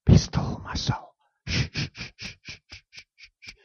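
whispered, fading into shushing; whispered hush. Shown as a demonstration. Preacher whispering to his soul, fading hush. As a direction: hush yourself like a child. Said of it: "Softly, softly".

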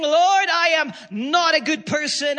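loud, high preacher's plea; fervent confession. Acted. Sinner falls upon the cross confessing, fervent loud plea. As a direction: cry out a confession in prayer.

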